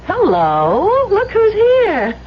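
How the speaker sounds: high, slow teasing sing-song; playful greeting. Acted. Daphne greeting Elwood at the station, teasing and slow. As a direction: draw it out like a coy tease.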